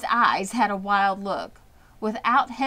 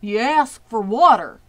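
usual speaking voice

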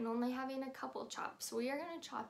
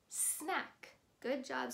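ordinary delivery

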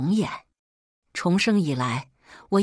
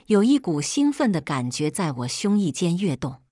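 usual speaking voice

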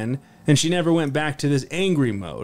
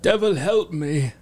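slow pleading monk voice; desperate monk; 4 semitones higher than usual. Acted. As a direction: beg the devil slowly. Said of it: "turning to the devil himself".

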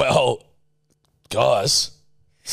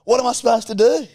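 much higher indignant character; high-pitched voice; 11 semitones higher than usual. Acted. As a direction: squeak it out indignantly.

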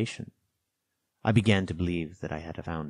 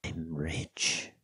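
hushed, secretive and softer; whisper; 7 dB quieter than usual. A narrator's reading. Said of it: "lowering his voice to a whisper".

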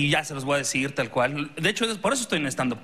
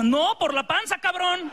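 mom voice; 11 semitones higher, slower than usual. Retold or imitated. Mom's punchline retort.

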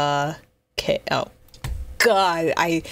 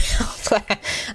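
breathy laugh; 4 semitones higher than usual. Real, not acted. Cracking up at own joke.

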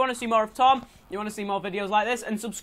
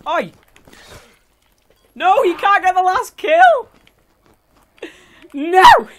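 loud high outraged cry; raging gamer; 10 semitones higher, 12 dB louder, slower than usual. Real, not acted. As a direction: yell at losing the kill. Losing the last kill.

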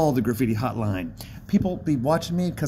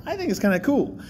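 ordinary talk, nothing marked

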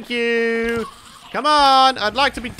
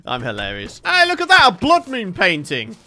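ordinary talk

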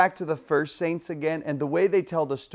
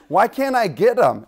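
loud, raised-pitch retold complaint; hammed-up exasperated question; mock frustration; 6 semitones higher, 8 dB louder than usual. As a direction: protest loudly like a thwarted boy. Acting Joseph failing to get plates.

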